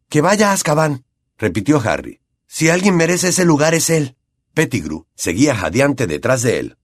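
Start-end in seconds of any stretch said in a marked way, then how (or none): 0.1-1.0 put-on higher-pitched boy's voice; lightly voiced resolute boy; insistent. A narrator's reading. Harry insisting on his decision. 2.5-4.1 put-on higher-pitched boy's voice; lightly voiced resolute boy; insistent. A narrator's reading. Harry insisting on his decision.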